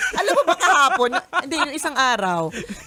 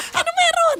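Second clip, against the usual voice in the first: very high mock-alarmed question; retold nervousness; 4 dB louder than usual. Wife retelling the panicked exchange with husband, mock nervousness. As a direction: blurt it out in comic nervous panic. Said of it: "Sinervyoso nga".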